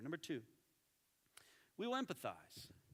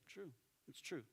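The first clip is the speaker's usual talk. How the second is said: ordinary delivery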